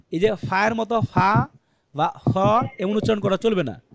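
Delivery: usual speaking voice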